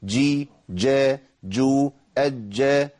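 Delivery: تجي with the jeem held firm and slow; slow model of the letter jeem; slow clear jeem. Shown as a demonstration. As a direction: say تجي slowly, the jeem stopped and voiced. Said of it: "دول السبع حالات الجيم".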